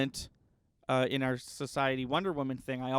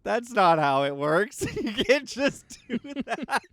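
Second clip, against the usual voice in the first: slow, loud, high incredulous protest breaking into a laugh; laugh-choked "that's not how it works"; exasperated disbelief; 9 semitones higher, 6 dB louder, slower than usual. Retold or imitated. Retold outrage at themed lip balm.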